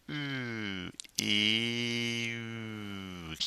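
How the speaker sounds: E sliding into French U, lips rounding; French U demo. Shown as a demonstration. Teacher drills French U for students, soft and exaggerated. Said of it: "Keep that E sound going and make an U".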